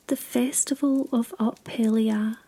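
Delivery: plain reading voice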